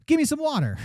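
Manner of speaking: high-pitched, hammed-up demand; slangy call-out. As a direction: call out like hailing a pal, pitch way up. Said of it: "I know how I would use the words".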